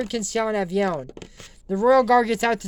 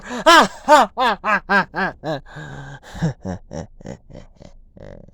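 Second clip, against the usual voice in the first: evil laugh; 3 semitones lower, 4 dB louder than usual. Pretend. Villain gloats at end.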